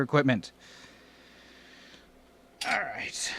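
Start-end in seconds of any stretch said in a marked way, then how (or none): 2.7-3.3 breathy whispered all right. Real, not acted. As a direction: whisper it to yourself. Whispered aside.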